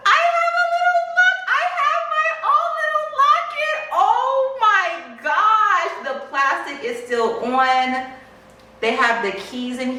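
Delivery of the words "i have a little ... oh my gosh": very high screaming excitement; squealing delight. Real, not acted. Buyer finding the bag's little locket, squealing. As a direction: squeal it, thrilled and far above normal pitch.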